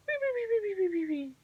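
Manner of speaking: high, descending "wee-wee-wee"; small creature snoring in falling squeaks; little animal snore. A narrator's reading. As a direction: whistle out a tiny squeaky snore. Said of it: "his friends snore on".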